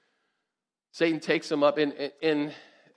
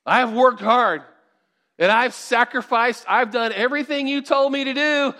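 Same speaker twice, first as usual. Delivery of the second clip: loud, higher, insistent complaint to God; demanding believer; 5 semitones higher, 6 dB louder than usual. Acted. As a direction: press God for your due, hammed-up.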